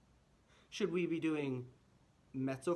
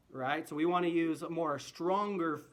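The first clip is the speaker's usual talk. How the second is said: ordinary talk, nothing marked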